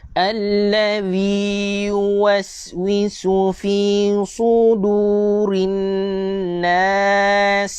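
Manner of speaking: slow chanted tajwid recitation; Quran recitation. Shown as a demonstration. Teacher reciting verse five for viewers, slow and melodic. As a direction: sing the verse slowly like a qari.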